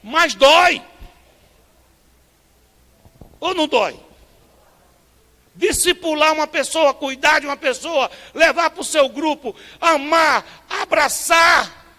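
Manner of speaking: loud, high, impassioned preacher; preaching at full force. Acted. Pastor preaching to the church, full-force and high. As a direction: preach it out with fire.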